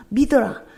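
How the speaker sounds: 믿어라 said as one loud, punched word; stress on 믿어라. Real, not acted. Pastor hammering home the point, 믿어라 loud. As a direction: land 믿어라 hard and alone. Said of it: "이거예요".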